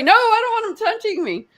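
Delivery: high, quick mock-panicked protest; alarmed refusal. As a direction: imitate a squeamish friend backing off. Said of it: "like, he jumps away".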